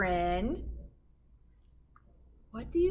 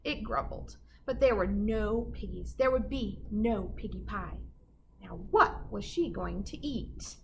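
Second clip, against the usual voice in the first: usual speaking voice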